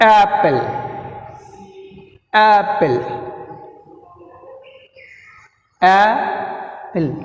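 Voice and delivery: teacher's model of Apple, first syllable stressed. Shown as a demonstration. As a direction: press hard on the first syllable of Apple, then fall. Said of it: "first సిలబుల్ని మీరు బాగా గట్టిగా".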